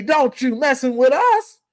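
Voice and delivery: ordinary talk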